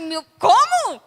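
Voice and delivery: slow, loud, drawn-out disbelief; stunned amazement. Retold or imitated. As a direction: stare in astonishment. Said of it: "eu ia ficar assim". (espanto lento e alto)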